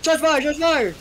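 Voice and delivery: high frantic yell; mock cop alarm call; shouting. Partner echoes the alarm.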